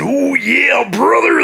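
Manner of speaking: hammed-up Macho Man shout; Macho Man impression. Shown as a demonstration. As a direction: bellow it like a wrestling promo. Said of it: "did he sound like this".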